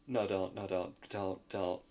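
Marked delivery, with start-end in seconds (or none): none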